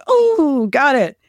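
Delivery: high, drawn-out "oh" of understanding; sudden realisation. Retold or imitated. Voices own dawning realisation.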